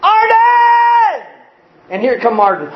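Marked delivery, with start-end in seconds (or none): 0.0-1.3 long high shout across the yard; calling out a name. Retold or imitated. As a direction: call Arden from far off.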